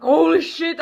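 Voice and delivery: a startled laughing gasp; shocked laugh at the creep. Retold or imitated. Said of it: "I got so fucking freaked".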